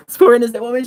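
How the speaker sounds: ordinary delivery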